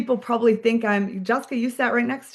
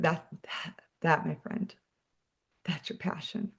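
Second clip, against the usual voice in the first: much softer, an intimate hushed voice; hushed reveal; 5 semitones lower, 11 dB quieter than usual. Retold or imitated. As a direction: lean in and say it almost under your breath. Telling a weeping recruit her passion.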